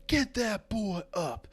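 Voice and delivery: high, slow command; sergeant order. Acted. Sergeant orders a cadet to lift the player, commanding. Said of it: "he's like".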